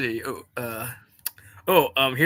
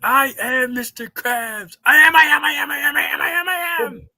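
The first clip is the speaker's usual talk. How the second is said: angry shout; 5 semitones higher, 6 dB louder than usual. Acted. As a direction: insist furiously, louder. Acted SpongeBob argument.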